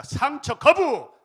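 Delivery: loud, high slogan shout; rally slogan. Acted. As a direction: lead the crowd in a battle cry.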